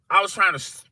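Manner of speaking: ordinary talk, nothing marked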